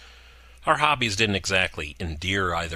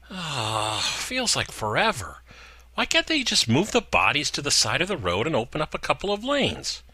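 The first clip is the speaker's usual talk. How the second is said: high, whiny voice for Gina; bored girlfriend griping about the crash, high; Gina, callous complaint; 9 semitones higher than usual. A narrator's reading. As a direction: whine it high and careless.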